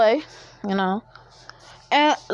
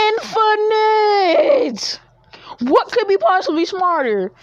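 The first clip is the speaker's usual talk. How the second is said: excited outcry; 8 semitones higher than usual. Real, not acted. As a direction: cry it out, dragging the word. Ranting about infinite brain.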